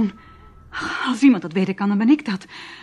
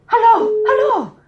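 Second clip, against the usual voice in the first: much higher, louder, slow calls; calling out; 11 semitones higher, 8 dB louder, slower than usual. Acted. The major hangs up, she calls out loudly into the phone. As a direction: shout after the caller down the dead line.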